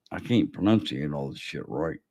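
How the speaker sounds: slurring around loose false teeth; words mushy from loose dentures. Real, not acted. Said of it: "my damn false teeth's in here".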